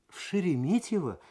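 high surprised question. A narrator's reading. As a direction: ask back in astonishment. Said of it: "удивлённо переспросила Светлана". (удивлённо, голос взлетает вверх)

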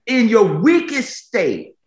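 high and slow, each word stretched; preaching climax. Acted. Preacher telling the weak they can win, high and slow. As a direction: lift the line up to the crowd.

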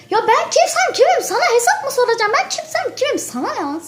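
high, fast mocking comeback; sassy retort. Girl mocks the rude question with a high sassy reply.

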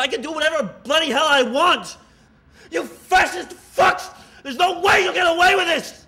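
furious defiant shouting; angry. Acted. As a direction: shout it at your captors. Prisoner defying his captors.

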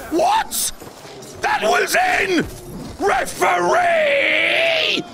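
high, drawn-out shouts at the referee; mock outraged shout. To the referee, a hammed-up outraged shout. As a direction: protest the call like a furious tennis player.

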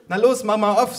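high, loud prodding mother; eager urging. A narrator's reading. Mother pushing a flat parcel over, high eager urging.

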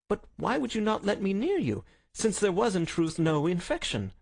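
lightly voiced high, querying Watson; puzzled friend. A narrator's reading. Doctor questioning the detective's deception, high and puzzled.

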